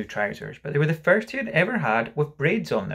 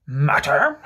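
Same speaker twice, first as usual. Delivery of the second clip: louder, indignant echo; mild yell; 6 dB louder than usual. Acted. Father answers mother's question, moderately yelling. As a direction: throw the word back, half yelled. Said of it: "he yelled".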